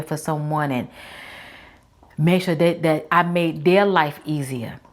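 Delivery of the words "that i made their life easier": ordinary delivery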